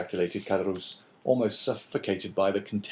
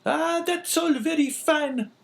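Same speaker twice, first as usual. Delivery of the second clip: much higher, louder, harsh woman's voice; sickly scolding wife; 5 dB louder, slower than usual. A narrator's reading. Wife calling down the stairs at husband, scolding. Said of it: "retorted the woman".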